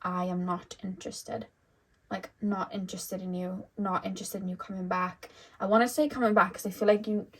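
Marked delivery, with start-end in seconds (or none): 0.0-5.3 soft, cold, final no; quiet firm refusal. Retold or imitated. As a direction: turn them away quietly, no heat. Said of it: "you could have literally said to this person".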